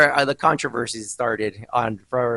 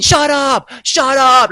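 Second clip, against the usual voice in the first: loud, higher mock yell of shut up; retold yelling; 10 semitones higher, 8 dB louder, much slower than usual. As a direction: imitate her yelling at the wrestler. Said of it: "starts yelling".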